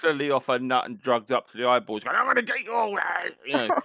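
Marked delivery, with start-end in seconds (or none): 2.2-3.8 high crazed granny. Retold or imitated. Granny voiced on a drone.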